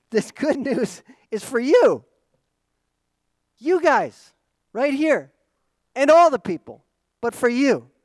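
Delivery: high, loud stress on "you"; emphatic "you". Shown as a demonstration. Angel singling out the shepherds, emphatic on "you". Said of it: "It's emphasized to tell these shepherds".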